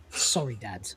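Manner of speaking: low, quiet, mock-contrite son; meek apology. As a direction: mumble a chastened apology, voice dropped. Chastened son answers his dad.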